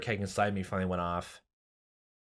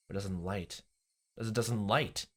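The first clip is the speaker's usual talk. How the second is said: ordinary delivery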